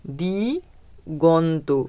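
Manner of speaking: Diganta stretched out very slowly, syllable by syllable; slow dictation. Shown as a demonstration. Teacher dictating the answer word to the class, very slow. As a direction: draw the word out so pupils can copy it.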